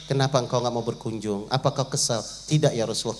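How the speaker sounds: low, grave narration of the angels; low hushed sermon voice for the angels' exchange; solemn low storytelling. Acted. Low solemn voice telling dialogue.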